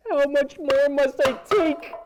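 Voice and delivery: desperate shout. Acted. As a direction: shout it to the heavens. Louder second take.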